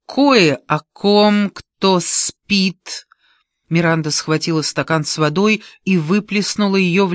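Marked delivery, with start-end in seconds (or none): 0.1-3.1 slow, raised, word by word; deliberate accusation. A narrator's reading. Keith exposing who is sleeping around, slow and deliberate. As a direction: spell out the charge with pauses.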